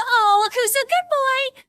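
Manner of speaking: high, loud, drawn-out cooing praise; sing-song good-boy voice; doting pet talk. Acted.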